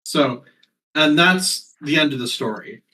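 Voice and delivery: faked wrap-up after a pause; mock story ending. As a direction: pretend the tale just finished. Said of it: "I know. I heard you. I'm kidding".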